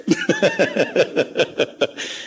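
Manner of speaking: a burst of high-pitched real laughter; hearty high laugh. Real, not acted. Preacher laughs at his story.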